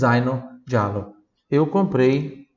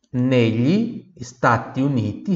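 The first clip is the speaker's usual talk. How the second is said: Negli Stati Uniti said slowly and clearly; slow model phrase; slower than usual. Shown as a demonstration. Teacher models the phrase for repetition. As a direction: say it slowly so they can copy.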